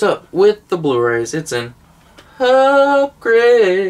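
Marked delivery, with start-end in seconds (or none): 2.4-3.9 long, high "upgrade"; drawn-out high announcement. Acted. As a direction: sing out the catchphrase, high and stretched. Catchphrase announced over Blu-ray purchase.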